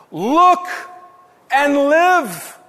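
a rising Look, then a falling live; preacher's echo, Look lifted high. Acted. Repeated, emphasised call.

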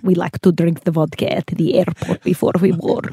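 a light mock Russian accent. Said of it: "Can you do that in your accent for me".

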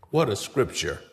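usual speaking voice

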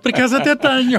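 laughing answer. Real, not acted. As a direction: answer while laughing, amused. Guest laughs answering the question.

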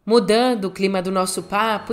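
normal talking voice

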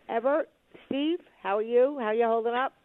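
usual speaking voice

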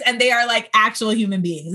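ordinary delivery

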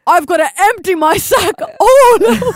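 high, shouted, over-the-top proclamation; hammed-up Father Christmas wailing out loud; Santa. Santa proclaims to the co-host, frantic comic shout.

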